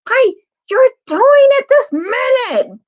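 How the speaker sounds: loud, high, slow outraged bellow. Acted. Trumpkin catching the bear sucking its paw, bellowing. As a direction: roar it out at him. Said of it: "bellowed Trumpkin".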